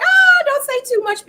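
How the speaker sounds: high, slow squeal of alarm; high mock alarm, slowed down; mock panicked warning. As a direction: squeal it like you're stopping a secret. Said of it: "I was like".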